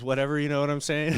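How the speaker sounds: fast tumbling throwaway; rushed aside. Real, not acted. Cohost rushing through his point about animal testing, fast. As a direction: rattle it off fast and loud.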